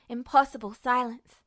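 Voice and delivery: usual speaking voice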